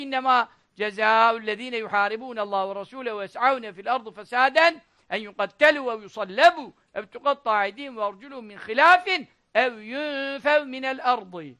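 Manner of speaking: sustained, measured Arabic chant-like verse; Quran recitation. Acted.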